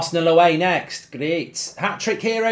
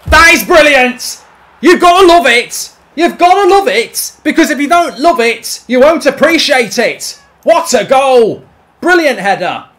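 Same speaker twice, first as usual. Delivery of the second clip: higher, louder joyful shouting; ecstatic celebration; 6 semitones higher, 10 dB louder than usual. Real, not acted. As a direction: roar with delight at the goal. Celebrating a goal.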